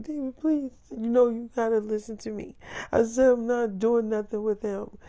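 high, whiny mock-crying plea; acted weepy insistence; imitated tearful pleading. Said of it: "So she calls back crying".

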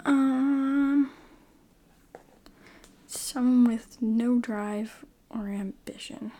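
ordinary talk

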